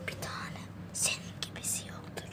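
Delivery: soft tender whisper. Real, not acted. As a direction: whisper it lovingly to a pet.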